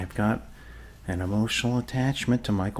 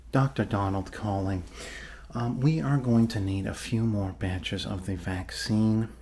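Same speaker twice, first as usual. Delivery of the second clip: ordinary delivery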